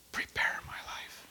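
hushed whisper, no voice. Shown as a demonstration. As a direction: whisper it as a prompt to the group. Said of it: "We're gonna whisper".

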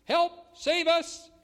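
desperate cry. Acted. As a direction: shout it like a drowning plea. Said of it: "With a cry of".

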